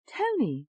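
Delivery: high, insistent English voice; English accent. A narrator's reading. Alter ego correcting her name, insistent English accent. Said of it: "said in an English accent".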